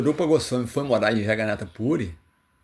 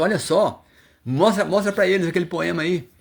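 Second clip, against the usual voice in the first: high, louder, eager coaxing; eager urging; 7 semitones higher, 4 dB louder than usual. Retold or imitated. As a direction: push him to show it, thrilled. Devotees excitedly urge Rupa.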